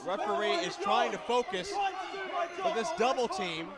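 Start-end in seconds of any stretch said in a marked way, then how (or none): none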